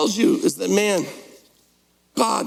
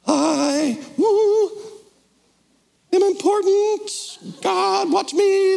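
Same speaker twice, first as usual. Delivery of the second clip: much higher, strained showy voice; mock self-important voice; 12 semitones higher, much slower than usual. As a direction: puff yourself up, "watch me". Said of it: "that sort of thing".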